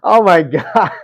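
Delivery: loud, high, laughing through words; can't stop laughing while apologizing; real laughter breaking the words; laughing apology. Real, not acted. Still laughing at comment.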